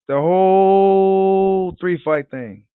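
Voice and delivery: very slow, stretched savoring of "The whole three fight thing"; drawn out slowly. Shown as a demonstration. Vlogger savoring the Wilder Fury trilogy, slow drawn-out repeat. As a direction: draw each word out like you're savoring it. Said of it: "repeated for emphasis".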